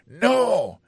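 high sharp angry No. Acted. Pete refusing the lender, angry shout. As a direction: snap it out angrily. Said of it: "Pi te reponn byen move".

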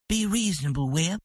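ordinary delivery, nothing marked

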